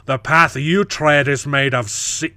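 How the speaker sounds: slow, loud Scottish declamation; Scottish accent. Acted. Quoting the sea water insult, hammed-up Scottish accent. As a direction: slip into a theatrical Scottish accent. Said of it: "I don't know why I'm doing Scottish".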